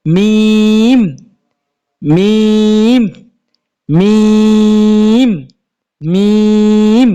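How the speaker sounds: মিম held long and firm; drawn-out Arabic mim. Shown as a demonstration. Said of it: "বারবার বলবেন".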